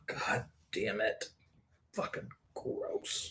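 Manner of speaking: slow unvoiced whisper of disgust; whispered real disgust. Real, not acted. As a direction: breathe it out after a bad drink. Disgusted after drinking.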